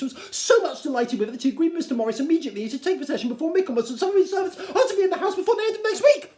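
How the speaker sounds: tumbling out the gossip breathlessly; excited Mrs Bennet. Acted. Wife rushing out the news to her husband, high and fast.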